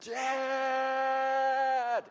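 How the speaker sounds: high, long-held holler; yelling for Dad. Retold or imitated. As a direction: cry out for help on one sustained note. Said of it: "And I yelled again".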